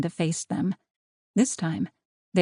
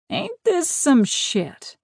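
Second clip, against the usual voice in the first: high, stretched-out Southern drawl; lazy Southern twang, long vowels; slow Southern drawl; 9 semitones higher, much slower than usual. A narrator's reading. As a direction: drawl it slowly with a slight Southern accent. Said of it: "Jet drawled in a slow, slight Southern accent".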